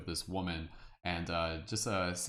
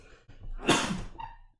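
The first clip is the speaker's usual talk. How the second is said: sudden loud sneeze; real sneeze; 3 dB louder than usual. Real, not acted. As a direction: let a real sneeze burst out. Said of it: "I'm gonna sneeze".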